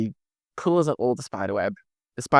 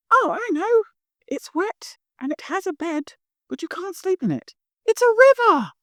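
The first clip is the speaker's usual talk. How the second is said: much higher excited nerd, realizing; Mimi's eureka. Acted. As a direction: elf solving the riddle, much higher. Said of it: "Mimi goes".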